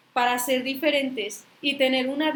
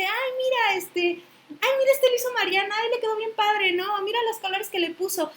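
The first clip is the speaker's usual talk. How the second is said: high, delighted gush over a handmade rug; gushing buyer; 7 semitones higher than usual. Retold or imitated. Buyer admiring the handmade rug, high delighted gushing. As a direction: coo with delight like an admiring shopper.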